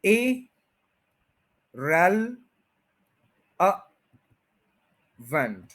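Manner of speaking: Irrelevant drawn out very slowly syllable by syllable; slow stretched demo. Shown as a demonstration. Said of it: "गौर करें जरा".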